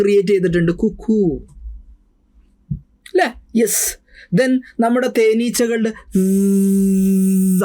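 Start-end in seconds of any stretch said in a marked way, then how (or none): 0.7-1.5 imitating the cuckoo's call. Shown as a demonstration. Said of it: "sound imitate ചെയ്തുകൊണ്ട്". 4.4-7.6 drawn-out imitated zzz of bees; teacher mimicking a bee's buzz. Shown as a demonstration. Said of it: "അതിന് നമ്മൾ ബസ് എന്നാ പറയാ".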